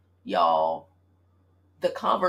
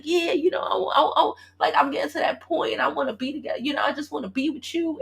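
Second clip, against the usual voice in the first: higher, a hint of a pleading ex; mock earnest plea; 7 semitones higher than usual. Imitating her ex's practiced plea to reunite, mock earnest. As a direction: ham up his rehearsed sincerity.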